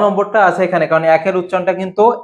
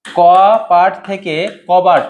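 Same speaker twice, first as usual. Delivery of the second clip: very slow, drawn out, কপাট leaned on; slow teaching demo; much slower than usual. Shown as a demonstration. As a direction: spell out "কপাট থেকে কবাট" slowly so students catch the change. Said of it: "তারপরে দেখো থাকতে পারে কপাট".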